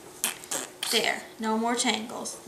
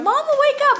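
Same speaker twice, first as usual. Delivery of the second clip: put-on high little-girl voice, much higher; imitating a little girl; 14 semitones higher than usual. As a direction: chirp it like a small daughter waking mom. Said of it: "she kept coming over and going".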